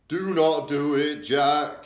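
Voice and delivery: loud, high, slow screech; the giant screaming at Jack to stop; giant's screech. A narrator's reading. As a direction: screech the plea in terror. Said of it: "he screeched".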